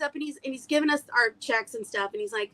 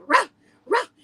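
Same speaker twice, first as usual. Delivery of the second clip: higher, louder yappy bark; playful imitated barking; mock bark; 6 semitones higher, 8 dB louder than usual. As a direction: bark like a little dog. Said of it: "he was like".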